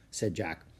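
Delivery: ordinary delivery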